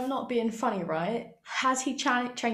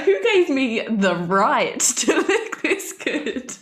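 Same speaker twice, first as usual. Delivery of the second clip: vain mirror boast; 2 semitones higher, 6 dB louder, much slower than usual. Retold or imitated. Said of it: "Beth just in the mirror being like".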